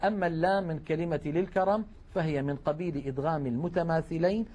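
ordinary talk

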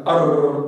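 أر with the tongue tapping several times in a rolled r; repeated r. Shown as a demonstration. Teacher showing the faulty repetition, a rolled r. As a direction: roll the r in أر, lingering on the tongue. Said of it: "اللي هو التكرار".

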